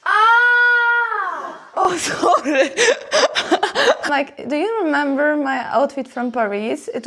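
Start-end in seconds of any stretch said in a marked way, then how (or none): 0.0-2.7 high shocked shout then a quick apology; startled cry. Real, not acted. Vlogger surprised by someone, crying out then apologizing. 2.8-4.0 high rising nervous giggle. Real, not acted. Vlogger laughing after being startled, a high giggle.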